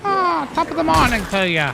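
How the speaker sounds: very high, hammed-up Irish brogue; cereal-ad leprechaun, sing-song Irish; Lucky Charms leprechaun. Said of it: "Leprechaun ... Lucky Charms Leprechaun".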